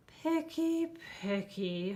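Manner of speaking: a light mock grumble, picky picky; complaining monster. A narrator's reading. Herbert grumbles at the picky child, fussy complaint. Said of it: "Herbert complained".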